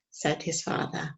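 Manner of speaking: usual speaking voice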